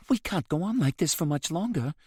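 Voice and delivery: a faint tremble of fear; touch of fear. A narrator's reading. Rose telling Hagen she is afraid, trembling. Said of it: "a touch of fear in her tone".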